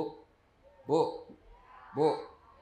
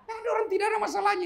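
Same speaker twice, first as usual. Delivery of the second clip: quick high aside; much faster than usual. Acted. Joking rhetorical aside.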